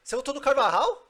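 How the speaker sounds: high, loud disbelieving question; can't believe the vote; surprised. Real, not acted.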